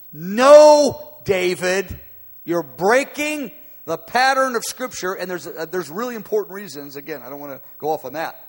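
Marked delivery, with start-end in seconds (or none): 0.1-5.1 sudden loud, high no to David; sharp rebuke. Acted. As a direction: stop him in his tracks.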